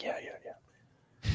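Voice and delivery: very soft, slow whispered reply; furtive whisper. Acted. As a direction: barely whisper it back, keeping it secret.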